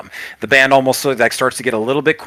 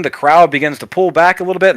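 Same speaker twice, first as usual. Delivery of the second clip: usual speaking voice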